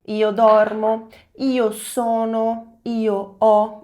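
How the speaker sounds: very slow, deliberate forms; careful teacher drill. Shown as a demonstration. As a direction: draw out each verb form. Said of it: "Io termina sempre com a letra O".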